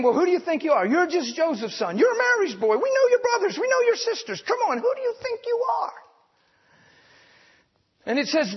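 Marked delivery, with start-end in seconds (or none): 0.0-5.9 mocking townspeople. Nazareth neighbors jeering at Jesus, high and mocking. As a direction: scoff at him like a jeering hometown crowd. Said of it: "They say to him".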